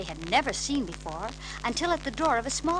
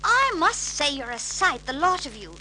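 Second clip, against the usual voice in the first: disapproving nanny; 6 semitones higher, 7 dB louder than usual. A narrator's reading. Mary Poppins disapproving of the floating children, prim. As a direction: look down on them with a tut.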